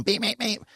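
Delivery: high, quick beep beep beep like a car horn; playful imitation of honking behind him; mock horn beeps. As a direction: toot it out like an impatient horn. Mimicking car horn impatiently.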